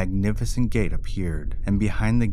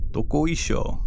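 a lively heave-ho cry as he jumps; exclaiming; 7 semitones higher than usual. Acted. Urashima jumping on the tortoise's back, exclaiming. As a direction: call it out with a jump. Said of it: "he exclaimed as he jumped on".

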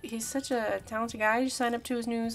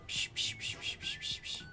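whispered spray noises; 13 dB quieter than usual. Pretend. Sound effect while drawing.

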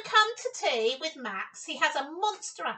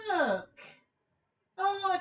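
ordinary delivery, nothing marked

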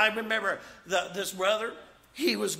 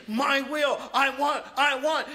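mock selfish demand; 5 semitones higher, 3 dB louder than usual.

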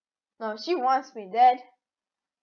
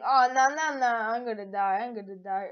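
normal talking voice

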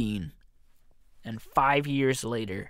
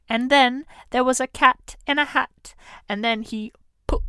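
higher, louder mock storybook voice for a kids' rhyme; Doctor Seuss storybook reading; 11 semitones higher, 6 dB louder than usual. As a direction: read it like a playful bedtime picture book, pitched up. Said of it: "that was a Doctor Seuss".

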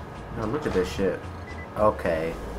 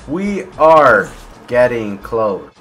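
very slow, stretched repeat; slow, deliberate suspense; drawn-out suspense; 2 semitones lower, 4 dB louder, much slower than usual. Real, not acted. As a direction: draw out every word at usual pitch.

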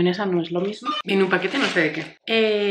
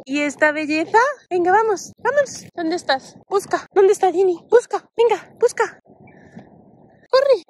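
dopey voice; 10 semitones higher, 3 dB louder than usual. Real, not acted. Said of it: "esta voz de tontorrones cuando hablamos con nuestros perros".

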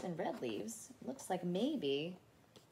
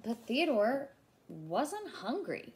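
usual speaking voice

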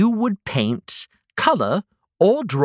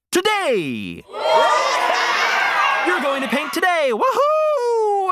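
excited shout; 14 semitones higher than usual. Acted. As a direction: cheer it out like a big announcement. Big reveal after drum roll.

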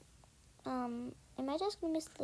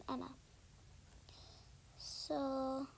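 ordinary delivery

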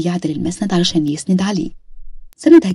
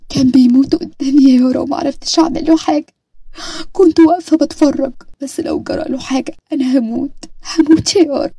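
a frightened wife who can't stop picturing him dying; distraught young wife; 8 semitones higher than usual. Acted. Nada panicked about her husband. (زوجة مذعورة بصوت عالٍ ومرتفع على وشك البكاء)

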